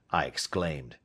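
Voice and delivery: ordinary delivery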